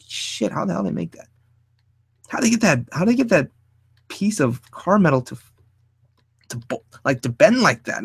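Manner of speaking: high-pitched, mock-baffled wondering; marvelling, imitating one's own disbelief; acted amazement. Acts out a driver marvelling at cars.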